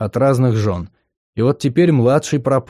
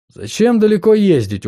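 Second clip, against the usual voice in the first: higher, sly, amused witch; smirking; 9 semitones higher than usual. Acted. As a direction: grin through the words. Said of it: "ухмыльнулась Фергия".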